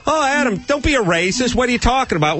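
mocking scold. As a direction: imitate the preachy critics lecturing you. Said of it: "And people think".

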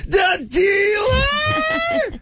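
a high, drawn-out shout of disbelief; shrieking disbelief. Retold or imitated. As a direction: stretch the word out in a shocked shriek. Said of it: "my voice went up like twenty-eight octaves".

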